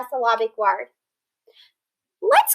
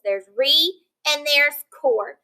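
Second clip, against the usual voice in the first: slow, each syllable set apart; slow syllable split; slower than usual. Shown as a demonstration. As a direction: spell out re and cord slowly. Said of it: "Let's clap the syllables in that word".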